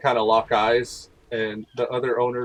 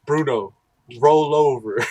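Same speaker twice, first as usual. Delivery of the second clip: louder triumphant order; mock owner command; 2 semitones higher, 5 dB louder than usual. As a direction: show off the trick. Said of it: "the guy who owns Bruno's like".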